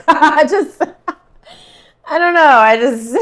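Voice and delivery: high, loud laughter breaking through the words; real giggling fit, words squeezed out between laughs; laughing hard. Real, not acted. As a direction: crack up mid-sentence. Laughing fit over the ice.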